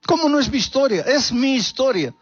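high, insistent woman's voice; woman insisting. Acted. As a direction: insist it's her story, high. (insistencia aguda de mujer)

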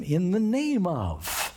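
NAME drawn out in "in the name of"; slow down and lean hard on "name"; stress on NAME. Shown as a demonstration. Preacher pointing listeners to the wording, stress on "name". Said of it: "notice the name again".

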